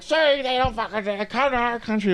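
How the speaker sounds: high put-on voice mocking an angry Irishman; bitching local guy. Local man griping at the visiting drinker, high mock rant. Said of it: "some guy was bitching at me".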